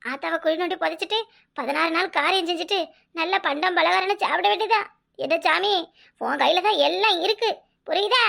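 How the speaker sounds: usual speaking voice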